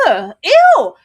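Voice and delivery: high, loud groans of revulsion; re-enacted revolted yelps; screamed disgust. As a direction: react loudly, utterly grossed out. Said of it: "I actively screamed".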